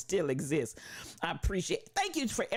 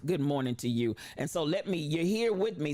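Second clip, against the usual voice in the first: ordinary talk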